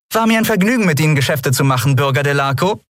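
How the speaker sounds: high, cheery sign-off; brightly cheerful farewell to a rival. A narrator's reading. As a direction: toss it off merrily, high and brisk. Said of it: "sagte sie fröhlich".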